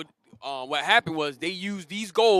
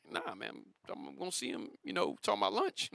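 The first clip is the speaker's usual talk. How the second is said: softer, half-whispered excuse; hushed reply; 11 dB quieter than usual. Retold or imitated. Worker quietly brushing off the boss, hushed and casual.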